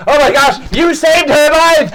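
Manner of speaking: high, loud cry of amazement; amazed crowd. Acted. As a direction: gush as an astonished onlooker, drawing it out. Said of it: "and everyone goes".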